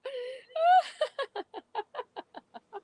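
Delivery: squealing high laughter. Shown as a demonstration. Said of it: "we go up and we laugh".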